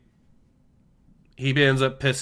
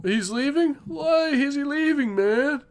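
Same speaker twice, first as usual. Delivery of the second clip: much higher pleading whine; whiny inmate voice; 12 semitones higher than usual. Retold or imitated. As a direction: play a dim, pitiful inmate whining. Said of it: "you hear him saying".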